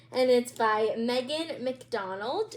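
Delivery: usual speaking voice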